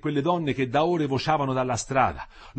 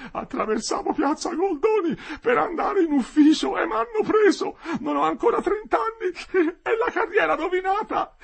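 high, loud, sobbing lament; tearful wailing; 6 dB louder than usual. A narrator's reading. Jailed clerk protesting his innocence, crying. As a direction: wail it like a weeping, wronged man. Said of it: "E da due giorni piangeva".

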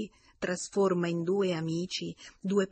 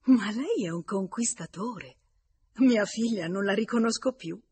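astonished remark, growing louder rather than murmured; a touch of amazed admiration; amazed mother; 4 semitones higher, 6 dB louder than usual. A narrator's reading. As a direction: marvel aloud, pitch lifting. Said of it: "stupita, mormorò".

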